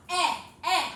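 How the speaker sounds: "Eh, eh" as two loud, separate short vowels; short E sound. Shown as a demonstration. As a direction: model a loud, crisp short-e "Eh" twice. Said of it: "Wash my mouth".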